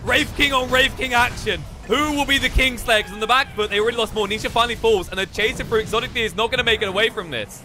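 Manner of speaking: high, rapid esports commentary; live play-by-play. Real, not acted. Caster calling a team fight live, excited play-by-play. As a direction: call the fight with rising urgency.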